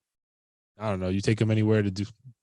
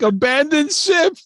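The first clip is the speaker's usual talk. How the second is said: mock dramatic; 6 dB louder, slower than usual. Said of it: "She was like".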